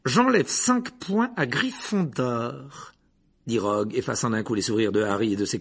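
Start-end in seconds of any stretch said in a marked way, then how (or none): 0.1-2.9 slow, cold, higher punishing voice; annoyed Snape. Acted. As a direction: dock the points with spite. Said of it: "visiblement contrarié". (sanction froide et lente)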